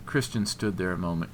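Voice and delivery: plain reading voice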